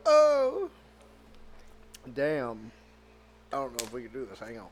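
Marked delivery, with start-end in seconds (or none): 0.0-0.7 high strained oh from spice; pained high oh. Real, not acted. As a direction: yelp at the heat. Burning hot pepper pain.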